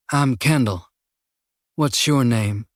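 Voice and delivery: unhurried Texan drawl with a lazy, friendly lilt; a killer's easy Texan drawl, just a hint of it; slight Texan drawl. A narrator's reading. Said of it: "a slight Texan drawl to his voice".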